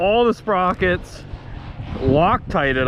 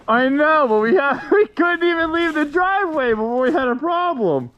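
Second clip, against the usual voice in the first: laughing; 11 semitones higher than usual. Real, not acted. Laughing at breakdown before leaving.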